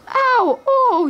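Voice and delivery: high, loud yelps of pain. A narrator's reading. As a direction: yelp as the punches land. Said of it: "yelled the guards".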